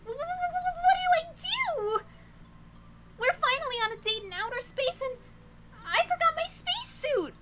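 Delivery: stammering, high-pitched loud freak-out; hammed-up panic. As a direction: panic theatrically, voice shooting up. Character panics without a spacesuit.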